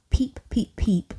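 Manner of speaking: high tiny whisper; teeny voice. A narrator's reading. Girl peeping in the dark, tiny whisper. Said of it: "I heard a teeny voice".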